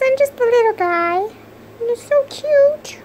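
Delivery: voicing the pet.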